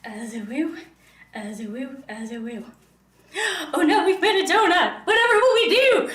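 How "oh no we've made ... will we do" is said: much higher and louder, hammed-up panic while laughing; mock dismay through laughter. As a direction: play the fake disaster, laughing as you say it. Mock panic over donut-shaped dough.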